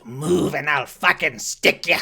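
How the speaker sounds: loud furious threat from across the street; angry half-orc yelling a threat; threatening shout. Acted. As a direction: bark it like you'll shoot.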